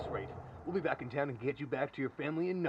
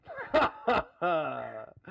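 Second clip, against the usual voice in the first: hearty laugh; 3 semitones higher, 5 dB louder than usual. Acted. Sheriff joking about the dog's help, hearty laugh. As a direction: laugh along at your own joke.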